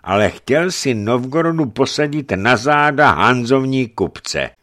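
high, loud accusing voice; indignant churchman's reproach; stern bishop. Acted. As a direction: rebuke the boyar forcefully.

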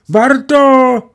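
put-on high, loud call of a little girl. A narrator's reading. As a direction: call his name out loud, like a child spotting a friend. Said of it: "A děvčátko vykřikne".

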